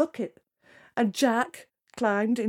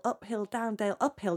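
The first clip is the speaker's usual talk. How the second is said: ordinary delivery, nothing marked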